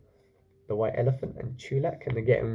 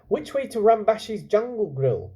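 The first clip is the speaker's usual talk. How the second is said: put-on higher, louder urging boy; 8 semitones higher, 4 dB louder than usual. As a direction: hurry the elephant along. Chulak asks villagers.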